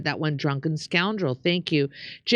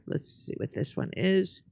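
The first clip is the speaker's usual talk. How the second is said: ordinary delivery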